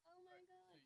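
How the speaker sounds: breathless whispered oh my God; barely voiced, just air in disbelief; stunned whisper. Stunned reaction to dad's advice.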